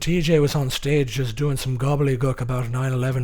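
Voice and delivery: lively mock Irish accent. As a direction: ramble like an Irish pub regular, accent and all. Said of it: "An Irish accent".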